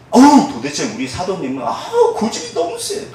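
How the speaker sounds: hammed-up grumble with a high sigh; mock exasperated grumbling. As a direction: pitch leaping up in exasperation. Mimics grumbling about stubborn apostle.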